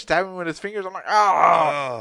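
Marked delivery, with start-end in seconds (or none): 1.1-2.0 loud, drawn-out acted "ah" of pain; mock pained yell. Patient being stabbed by the doctor's fingers, mock pained yell.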